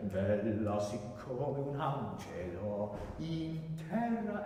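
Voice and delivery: slow, deliberate Italian declamation; new intention. Shown as a demonstration. Coach shows singer the repeated phrase, slow and intentional. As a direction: speak the phrase drawn out and purposeful. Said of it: "give it new intention".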